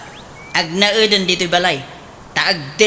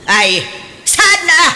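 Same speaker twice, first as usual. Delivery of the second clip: high, loud outraged question; shocked indignation; indignant shock; 7 semitones higher, 3 dB louder than usual. Acted. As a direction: demand an answer in disbelief. Mother outraged at refusal.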